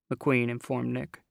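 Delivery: usual speaking voice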